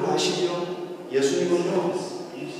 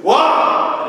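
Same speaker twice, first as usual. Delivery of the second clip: commanding call; 6 semitones higher, 7 dB louder than usual. Acted. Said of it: "이렇게 얘기했어요".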